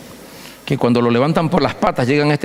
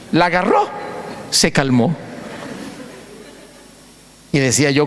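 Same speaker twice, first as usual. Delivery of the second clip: ordinary delivery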